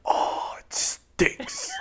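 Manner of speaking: whispered, no voice; whispering. Pretend. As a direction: breathe it in a hush. Fudd-style whispered joke.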